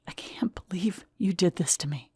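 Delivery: put-on a betrayed girlfriend whispering her disbelief to him; whispered. A narrator's reading. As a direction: breathe it out in a tearful whisper. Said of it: "I whispered".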